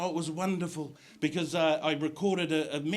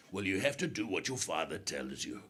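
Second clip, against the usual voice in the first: stern Santa; 9 semitones lower, 6 dB quieter than usual. Retold or imitated. Santa scolding child.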